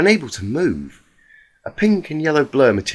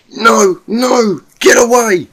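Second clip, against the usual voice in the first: high, loud terrified cry of a pony in a nightmare; panicked screams; 12 semitones higher, 10 dB louder, slower than usual. Acted. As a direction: scream it out in pure terror, much higher and louder. Nightmare, fighting off attacker.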